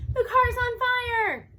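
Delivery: high, loud, slow panicked cry. A narrator's reading. Teen driver sees flames behind, panicked cry. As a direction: shout it out in alarm. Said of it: "I panic".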